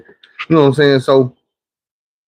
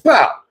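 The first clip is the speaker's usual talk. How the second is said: mock impact sound; 9 semitones higher than usual. Imitating the cigarette pack hit.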